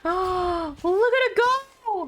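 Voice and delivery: high, drawn-out cooing wonder; excited marvelling. Real, not acted. Player watching it go, excited. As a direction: coo high and long as it takes off.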